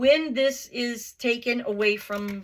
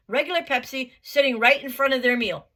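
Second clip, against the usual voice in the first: ordinary talk, nothing marked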